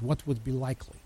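quiet, lightly imitated Polish accent. Preacher quoting a speaker on heaven, imitated Polish accent. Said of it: "in his accent".

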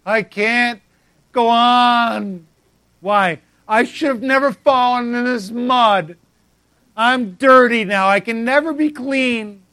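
loud, slow, self-pitying wail; whiny fallen friend. Pretend. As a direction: wallow in the mud, pitiful and loud. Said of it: "they just lay around in it".

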